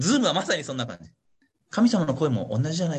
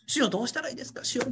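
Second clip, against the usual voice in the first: earnest plea; 7 semitones higher, 4 dB quieter, faster than usual. Retold or imitated. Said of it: "熱心に求めてた。".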